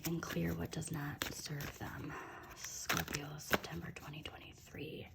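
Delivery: breathy hushed reading of the card; hushed, secret-sounding card read; whisper. Real, not acted. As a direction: whisper the card's words, slowed down. Reading the card text aloud.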